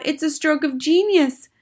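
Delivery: high-pitched admiring exclamation; crying out. Acted. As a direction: gush as an impressed crowd of witches. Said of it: "they cried out".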